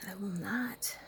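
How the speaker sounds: hushed whisper. Real, not acted. Woman confiding about her angry partner in a whisper. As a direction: keep it to a secret whisper.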